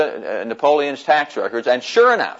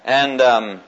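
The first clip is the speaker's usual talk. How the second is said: ordinary talk, nothing marked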